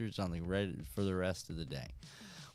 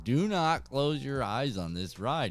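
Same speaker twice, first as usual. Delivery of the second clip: loud, raised mock order; emphatic dad warning; 6 semitones higher, 5 dB louder than usual. Dad warning son before the drop, emphatic retold order. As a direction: warn him firmly, pitched up.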